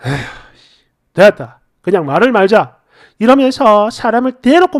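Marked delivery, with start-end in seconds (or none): 0.0-2.8 low, dismissive acted husband; sigh, then give up on the argument in a lowered voice; exasperated sigh. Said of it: "한숨을 푹 쉬는 거야".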